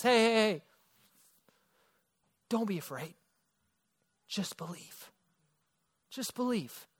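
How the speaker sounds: calm a frightened father; tender reassurance. Retold or imitated. Jesus calms Jairus.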